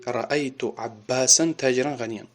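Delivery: "Аббасан таҷиран ғаниян", each word ending in -an; fatha endings. Shown as a demonstration. Teacher gives the object example, pronouncing "Аббасан таҷиран ғаниян" with -an endings. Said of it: "Ба фатҳа гуфтум".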